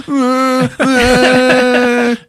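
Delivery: high, loud mock bawling; fake crying wail. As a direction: bawl like a kid faking tears. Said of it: "I whipped up some tears".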